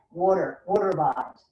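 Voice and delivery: "water" said the New York way, wɔtə with a rounded aw; a playful New York "Water, water bottles"; New York accent. Shown as a demonstration. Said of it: "Did you hear my New York accent?".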